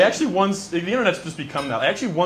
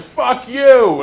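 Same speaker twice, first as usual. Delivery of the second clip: sudden furious yell, higher and louder; furious shout; 8 semitones higher, 10 dB louder than usual. Retold or imitated. Wronged stranger at a hundred and ten percent, yelling angrily. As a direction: blow up at them all at once. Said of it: "just like".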